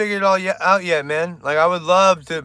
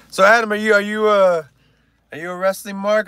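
normal talking voice

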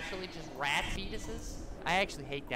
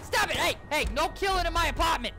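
high, loud mock outrage; raised-voice scolding, pitched up; indignant shouting; 8 semitones higher, 7 dB louder than usual. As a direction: shout them down to stop it. Objecting to a killing in game.

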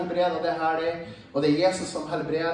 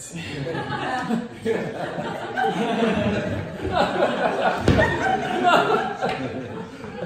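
long hearty laugh; 5 semitones higher than usual. Real, not acted. Laughing at her running off.

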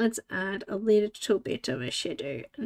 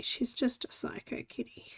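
unvoiced whisper about the cat; 10 dB quieter than usual. Real, not acted. Telling viewers about her cat, a whisper. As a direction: whisper it conspiratorially.

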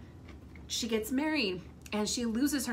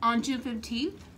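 talking with a mouthful of food; mouth full; 4 semitones higher, 3 dB louder than usual. Real, not acted. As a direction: say it round a bite of slider. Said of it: "Sorry, I have food in my mouth now".

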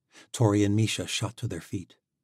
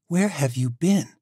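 higher, louder, slow accusing question; 8 semitones higher, 6 dB louder than usual. Acted. Tori confronts Alec, higher, louder and slow. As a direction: drag it out, she's furious.

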